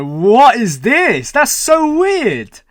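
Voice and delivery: drawn-out, high, loud disbelief; baffled amazement. Real, not acted. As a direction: stretch it out, climbing in sheer bafflement. Baffled reaction to the ending.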